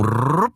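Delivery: rolled R for sliding down; imitated slump off a bench; rolled trill noise. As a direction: trill a quick slide-and-fall effect.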